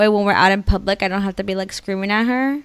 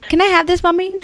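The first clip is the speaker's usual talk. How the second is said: put-on higher, wheedling toddler ask; sweet little girl; 8 semitones higher, 4 dB louder, faster than usual. Mom imitating her daughter asking for something, wheedling child voice.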